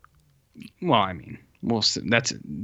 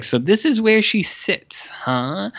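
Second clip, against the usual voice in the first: high, slow creepy leer; a creep savouring her seat, pitched high and slow; creepy guy; 8 semitones higher, slower than usual. Retold or imitated. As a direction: leer over each word, slowly and up high. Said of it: "that really forceful guy".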